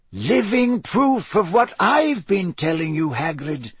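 put-on lightly amused elderly wizard; Dumbledore voice. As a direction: play the wise old headmaster, gently teasing.

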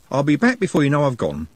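bright, lifted reassurance; cheerful and brisk. A narrator's reading. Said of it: "said brightly".

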